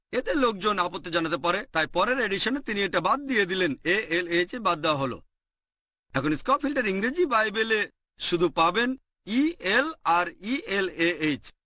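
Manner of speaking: ordinary delivery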